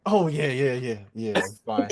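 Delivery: high, loud cover-up answer; acted hasty reassurance; overeager reassurance. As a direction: insist too eagerly that all is well.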